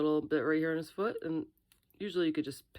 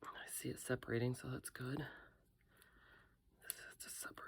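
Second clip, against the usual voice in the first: whispering; 3 semitones lower, 11 dB quieter than usual. Real, not acted. Careful demolding, whispers.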